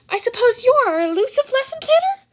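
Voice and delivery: a delighted tiny principal; Nezu voice. Acted.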